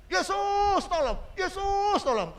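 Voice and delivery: slow, high desperate cry for Jesus. Retold or imitated. Sick man crying out to Jesus, slow and high. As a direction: stretch out each word as a plea. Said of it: "dia berteriak".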